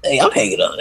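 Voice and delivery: usual speaking voice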